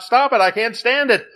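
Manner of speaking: high, pained plea; teacup crying out. Acted. Teacup gagging on paint fumes, high and pained. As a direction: beg through the fumes. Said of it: "I cried out".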